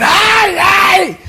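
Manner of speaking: high, shouted cries of a beaten wife; mock cries of pain. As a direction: cry out high as if struck. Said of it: "la estaba golpeando y la mujer". (gritos agudos de dolor imitados)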